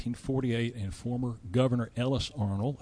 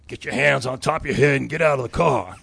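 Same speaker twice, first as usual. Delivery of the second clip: put-on loud Southern-accented deputy sheriff order; 2 semitones higher, 10 dB louder than usual. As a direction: bark it like a Southern cop making an arrest. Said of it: "a genuine Southern accent".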